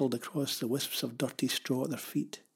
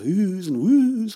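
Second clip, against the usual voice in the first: loud, raised spooky oohs; ghostly wailing; 5 semitones higher, 8 dB louder, much slower than usual. A narrator's reading. Pavel playing ghost among the shadows, spooky wail. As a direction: make the words woo like a ghost. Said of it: "ghost fashion".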